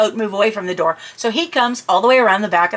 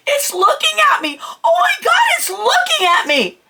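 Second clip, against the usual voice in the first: freaked out. Retold or imitated. Telling her husband about the goat, panicked shriek. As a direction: shriek it like you've been caught off guard. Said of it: "I was totally freaked out".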